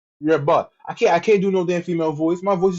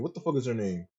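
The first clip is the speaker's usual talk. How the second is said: muttered to himself; 6 semitones lower, 12 dB quieter than usual. Real, not acted. Streamer straining to recall a rival's name, muttering quietly. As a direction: drop much softer, lower, and mutter it. Repeats the question under breath.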